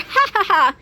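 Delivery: high, spelled-out "ha ha ha"; forced character laugh. Acted. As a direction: laugh at your own pun. Laughs at own bee pun.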